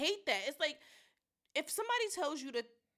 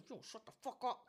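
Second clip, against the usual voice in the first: imitated hushed threat; 7 dB quieter than usual. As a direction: threaten under your breath. Mother silencing daughter.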